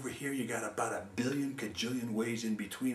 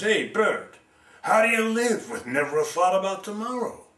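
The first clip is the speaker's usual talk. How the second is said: high, loud mocking crow; a crow taunting a carefree sparrow; crow's challenge; 5 semitones higher, 8 dB louder than usual. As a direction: call down to the bird from the tree.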